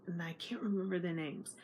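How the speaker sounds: whispered, embarrassed aside; sheepish whisper. Real, not acted. Narrator admitting she forgot names, whispering. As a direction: whisper it like a confession.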